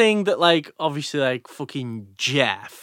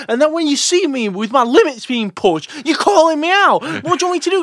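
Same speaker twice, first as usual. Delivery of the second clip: high and loud frustrated complaint; indignant rant; 12 semitones higher, 5 dB louder than usual. Real, not acted. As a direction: demand an answer, fully wound up. Ranting about spicy curry.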